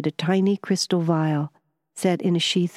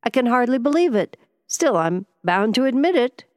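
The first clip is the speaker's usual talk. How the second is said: high, louder burst of wonder; amazed relief; 8 semitones higher, 4 dB louder than usual. A narrator's reading. Harland feeling his pain vanish, amazed high relief. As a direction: let it out, hardly believing it.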